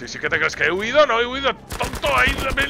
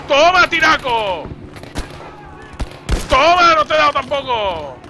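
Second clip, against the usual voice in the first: drawn-out, high-energy yell; loud throwing shout; 3 semitones higher, 5 dB louder, slower than usual. Real, not acted. Player taunts enemy with each throw, loud shout. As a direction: yell it as you hurl.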